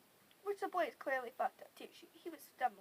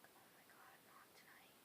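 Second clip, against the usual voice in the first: barely audible whispered complaint; exasperated whisper; 26 dB quieter than usual. Retold or imitated. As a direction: whisper it to yourself in dismay. Muttering to herself, retold.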